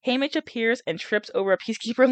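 plain reading voice

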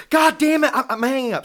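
shrill, high, loud exasperated outburst; mock the fed-up fiance yelling; fed-up fiance snapping at him on the phone; frustrated fiance. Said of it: "She said".

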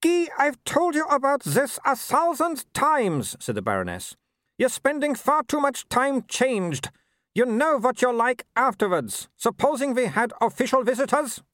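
high, imperious scolding; scolding Baroness. A narrator's reading. Mother scolding husband for changing, high imperious voice. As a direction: order him about like an errant dog.

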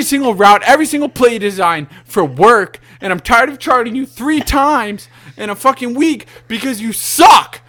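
loud, high, heated rant at the team; furious rant. Real, not acted. Fan ranting at the team over its play calling, angry shouting. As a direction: vent real built-up anger, shouting it out. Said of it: "that was an all-time rant".

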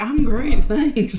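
high-pitched answer through laughter; hammed-up high, giggly thanks; mock cheery reply. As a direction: squeak out a polite reply, cracking up. Retells own awkward reply, laughing.

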